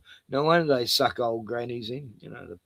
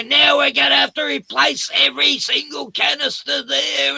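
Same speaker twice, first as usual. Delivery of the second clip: high, loud retold scream; mock screaming; 12 semitones higher, 8 dB louder than usual. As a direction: shout like a furious shop worker. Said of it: "she starts screaming".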